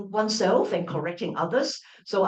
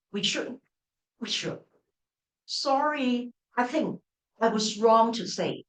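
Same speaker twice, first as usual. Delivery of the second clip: teacher demoing self-correction phrases with lively intonation; 2 semitones higher than usual. Shown as a demonstration. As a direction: act out catching your own mistake mid-sentence. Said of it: "this is to show that what I have just said was problematic".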